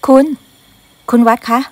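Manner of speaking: calling out. Acted. Maid calls young master loudly to wake him. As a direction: call him loudly to get his attention.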